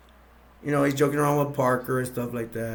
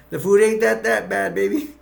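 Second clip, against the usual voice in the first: joking Parker; 7 semitones higher, 5 dB louder than usual. Retold or imitated. Parker teasing Kane at dinner.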